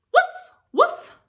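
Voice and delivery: high, loud little puppy woofs; dog barks. Acted. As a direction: bark it like an excited puppy. Dog barks in picture book.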